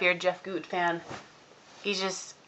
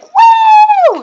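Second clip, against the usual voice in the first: high, loud fangirl "woo"; shrieking mock cheer; crowd scream; 16 dB louder than usual. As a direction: imitate girls cheering at full pitch. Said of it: "everyone started screaming".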